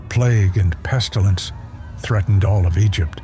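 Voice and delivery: ordinary talk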